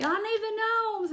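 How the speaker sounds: high, slow pleading whine; high drawn-out mock plea; customers begging. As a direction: mimic a customer begging for gnomes. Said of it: "And then it's like".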